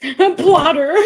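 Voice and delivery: laughing. Real, not acted. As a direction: blurt the name through a laugh. Laughing at the dog's name.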